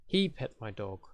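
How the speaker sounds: "He" stressed, the rest falling away. Shown as a demonstration. Teacher shows stress changing focus, stress on "He". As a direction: lean on "He" to single out who did it. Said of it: "four words in a sentence that could be stressed".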